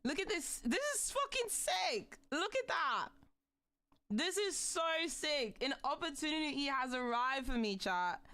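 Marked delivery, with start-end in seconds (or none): 0.0-5.6 high, thrilled gushing over the model; excited. Real, not acted. Streamer showing chat the new model, excited. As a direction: squeal with delight at it. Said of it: "I'm so excited".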